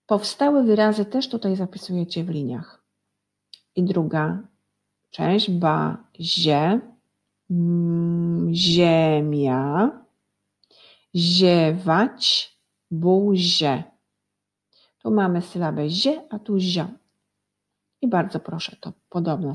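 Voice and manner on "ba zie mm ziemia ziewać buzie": "ziemia" stretched after a long hummed "mm"; drawn-out syllables. Shown as a demonstration. Teacher joins syllables into words for pupils, drawn-out syllables. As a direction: draw out "Ziewać" and "buzie" syllable by syllable. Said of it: "będziemy łączyć sylaby".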